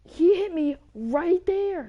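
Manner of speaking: loud, slow, high complaint; indignant protest. Real, not acted. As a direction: protest the foul loudly. Disputing a penalty.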